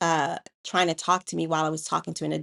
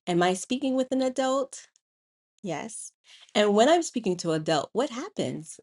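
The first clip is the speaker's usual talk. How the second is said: soft teacher voice; 3 semitones higher, 5 dB quieter than usual. Retold or imitated. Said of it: "I went to the child and I said".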